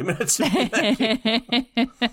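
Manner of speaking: words broken by laughter; laughing. Real, not acted. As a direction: laugh through it. Laughing while telling story.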